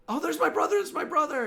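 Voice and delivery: very high, loud joyful cry; relieved sister. Acted. Sister spotting her lost brother, high and relieved.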